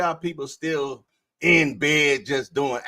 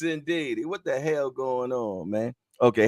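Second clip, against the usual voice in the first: ordinary talk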